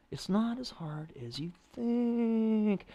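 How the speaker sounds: slow, high, swooping melodic delivery; sing-song. Shown as a demonstration. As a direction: sing it out with a long high THINK. Sing-song demonstration of pitch.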